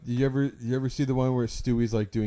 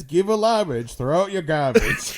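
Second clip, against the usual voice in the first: mascot voice; 5 semitones higher, 5 dB louder than usual. Retold or imitated. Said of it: "Gary says".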